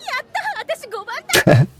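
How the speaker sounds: high squealing giggle; delighted high squeal of a laugh. Real, not acted. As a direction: burst into a high little giggle. Fangirl reaction to character.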